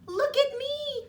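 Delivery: put-on high, bright fairy-girl call; excited cry. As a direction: call out with glee. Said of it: "cried Abigail".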